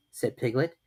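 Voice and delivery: ordinary delivery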